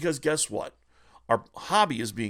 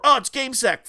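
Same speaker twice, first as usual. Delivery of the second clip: mocking whiner; 9 semitones higher, 7 dB louder than usual.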